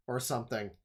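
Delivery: ordinary delivery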